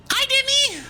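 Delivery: excited high greeting. Real, not acted. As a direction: greet a friend with delight. Greeting a raider.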